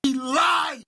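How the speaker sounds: high, shouted mock outrage; a high mocking yell. Co-host mocks Harden's liar claim.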